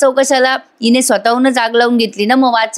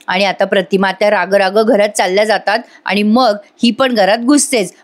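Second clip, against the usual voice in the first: usual speaking voice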